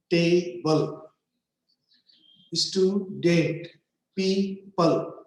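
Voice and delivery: "table", "student", "people", each split by a short break; broken in two parts. Shown as a demonstration. Teacher showing two-part words to learners, syllable by syllable. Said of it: "pronounced with one break".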